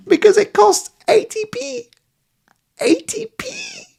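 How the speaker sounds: high squeaky falsetto, last words a mock whimper; squealed price. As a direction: shriek the price in giddy disbelief.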